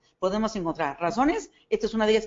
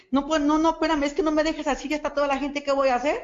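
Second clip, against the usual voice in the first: panicked plea; 5 semitones higher than usual. Retold or imitated. Recruiter retelling begging her sponsor by phone, panicked. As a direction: beg her not to leave you alone.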